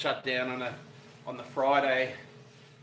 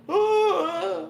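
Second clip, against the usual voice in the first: neighbour's startled cry; 7 dB louder than usual. Retold or imitated. Neighbour comes out after bang.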